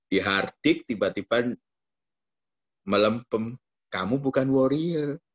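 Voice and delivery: ordinary talk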